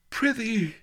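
high, strained groan. A narrator's reading. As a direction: groan out the plea. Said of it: "groaned the pendant rascal".

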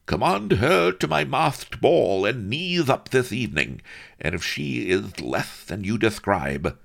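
high, languid lisping Viceroy; haughty lisping viceroy. Acted. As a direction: order it with lazy menace. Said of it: "lisped Don Lardo".

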